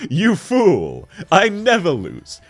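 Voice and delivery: high, loud hammed-up outburst; mock villain boast. Streamer scoffing at a viewer who doubted them, hammed-up boast. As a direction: proclaim it with mock grandeur.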